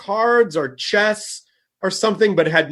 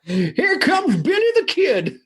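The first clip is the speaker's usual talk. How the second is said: mock announcer; 10 semitones higher than usual. To his cohost, a mock Old West showdown call. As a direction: call the gunfight like a ringside announcer.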